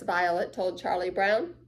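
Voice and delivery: ordinary delivery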